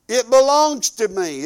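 ordinary talk, nothing marked

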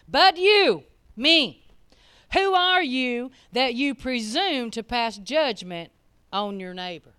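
loud, slow, deliberate; hammering the verse home slowly; slow preached scripture. Acted. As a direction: lean on every word. Preached question.

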